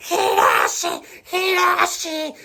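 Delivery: high, loud, frantic screaming of Hiroshi; strangled wild scream; screaming a name. Retold or imitated. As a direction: shriek the name like a desperate woman. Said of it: "Y de repente grita".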